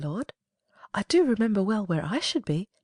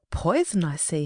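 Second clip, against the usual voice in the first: ordinary talk, nothing marked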